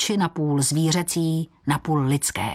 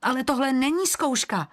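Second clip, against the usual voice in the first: higher-pitched, mild cry of protest; 7 semitones higher than usual. A narrator's reading. Eagle protests to the guardian about the trial, higher-pitched mild outcry. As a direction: object in a raised pitch, without real shouting. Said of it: "vykřikl orel".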